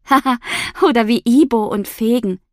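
cracking up at her own joke; laughing. Acted.

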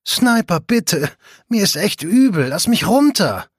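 high, loud whiny plea to be put down; whining. A narrator's reading. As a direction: whine like a nauseous girl being carried. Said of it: "quengelt die kleine Miss Bowman".

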